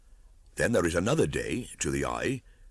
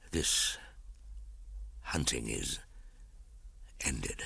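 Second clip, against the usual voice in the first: fading dying words; 5 dB quieter than usual. A narrator's reading. Dying Akela's last words.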